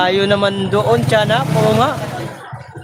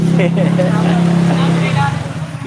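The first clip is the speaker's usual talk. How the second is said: burst of laughter. Real, not acted. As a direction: crack up at the joke. Laughing at the word puday.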